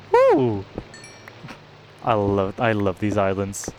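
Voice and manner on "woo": high, loud whoop; cheer. Real, not acted. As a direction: whoop with joy.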